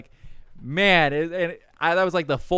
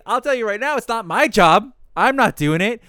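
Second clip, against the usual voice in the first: loud, higher, defiant 'not my job'; defiant refusal; 6 semitones higher, 6 dB louder than usual. Retold or imitated. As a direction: push back hard, mock-defiant. Defiant retort to coworker.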